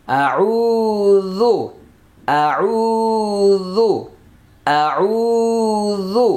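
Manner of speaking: আউযু chanted very slowly with the ও vowel stretched one alif long; drawn-out recitation. Shown as a demonstration. Teacher modelling for students, slow stretched আউযু. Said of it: "এক আলিফ টেনে পড়তে হবে".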